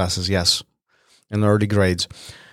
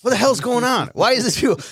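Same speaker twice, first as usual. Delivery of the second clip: pitched way up, baffled and irritated; exasperated outburst; 12 semitones higher than usual. Retold or imitated. Annoyed boy asks why classmates keep shushing him, high exasperated. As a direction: squeak out your disbelief.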